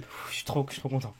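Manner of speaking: soft, breathy overwhelmed delight; breathless joy. Real, not acted. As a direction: sigh it out, overcome with happiness. Overwhelmed joy after good pull.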